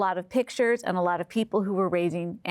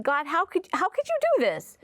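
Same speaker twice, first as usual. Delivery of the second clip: high-pitched, mildly angry protest; retold anger; 8 semitones higher than usual. Pregnant mother's retold prayer to God, pained anger. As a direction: challenge God in disbelief, voice climbing. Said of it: "at first I was angry".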